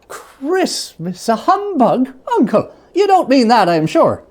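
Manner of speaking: can't believe his ears, pleading; disbelieving nephew. A narrator's reading. Nephew protesting his uncle's humbug, high and loud.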